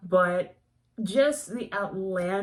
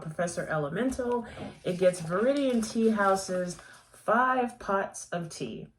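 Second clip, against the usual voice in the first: ordinary delivery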